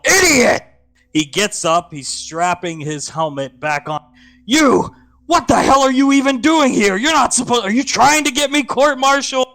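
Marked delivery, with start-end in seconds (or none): 0.0-0.6 furious shout, much higher and louder; angry yell. Acted. Hunk yelling at the girl, furious shout. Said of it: "You hear Hunk yell". 4.5-9.5 high, loud furious rant; angry scolding. Acted. Hunk berating his sister for following him, furious.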